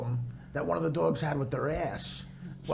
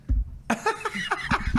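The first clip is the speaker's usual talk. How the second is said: high breathy laugh. Real, not acted. Laughing at question.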